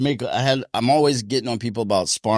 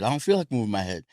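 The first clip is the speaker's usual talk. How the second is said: usual speaking voice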